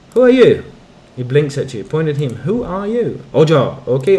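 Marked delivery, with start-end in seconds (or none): 0.1-0.7 sharp loud challenge; loud demand. Acted. Player demanding to know who the stranger is, loud challenge. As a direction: bark the question at him. Questioning a dim villager loudly.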